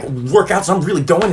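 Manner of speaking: ordinary delivery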